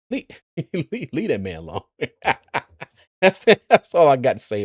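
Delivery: Forrest Gump. Acted. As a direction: imitate the simple Southern film hero, chuckling. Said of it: "in my Forrest Gump voice".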